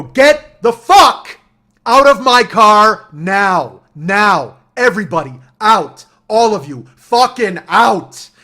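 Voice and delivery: slow, high bellow, every word punched; furious shout. Retold or imitated. Driver throws everybody out of the car, furious shout. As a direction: roar the order with full force.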